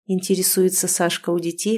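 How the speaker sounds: plain reading voice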